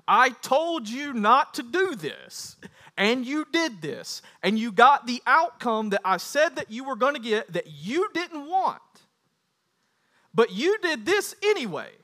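high, emphatic telling-off; stern reproach. Acted. Acts out a stern I-told-you-so.

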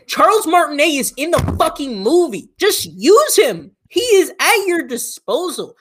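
high-pitched, incredulous ranting; exasperated. Real, not acted. Co-host ranting to friend about Mario casting, exasperated. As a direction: protest with big swooping pitch on the key words.

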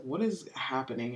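low, hushed retold question; imitating baffled dad. Host quoting her dad walking in, quietly baffled. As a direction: ask it softly, as a bewildered parent.

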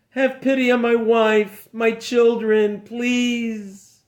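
high, pleading for his life; a hint of desperate begging. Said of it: "he began begging for his life".

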